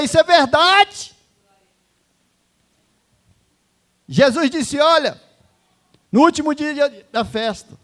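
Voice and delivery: preaching at full force. Acted. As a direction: lift the crowd with full voice. Preaching climax. (pregação inflamada, alta e aguda)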